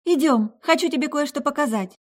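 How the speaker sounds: eager high-pitched friend; bright and high, coaxing; high excited girl voice. Acted. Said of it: "раздался голос за спиной".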